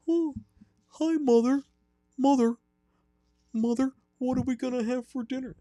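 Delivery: put-on high-pitched Wisconsin hockey accent; squeaky high Wisconsin killer calling his mother; Ed Gein voice. Caller doing his killer voice to mother. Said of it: "I've been working on my Ed Gein voice. It's a Wisconsin hockey accent, but with a real high pitch".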